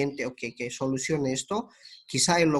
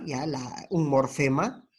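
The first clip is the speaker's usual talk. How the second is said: ordinary talk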